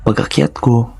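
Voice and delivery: ordinary delivery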